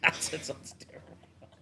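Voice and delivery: slow teasing whisper, mostly breath; a playful stage whisper; mock whisper. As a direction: lean in and breathe it like a secret joke. Teasing echo of a remark.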